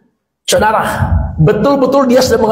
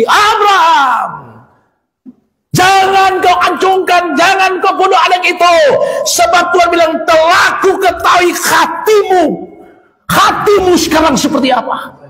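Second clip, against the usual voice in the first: voice of God; 7 semitones higher than usual. Acted. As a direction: boom out the command to stop. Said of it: "suara Tuhan terdengar begini".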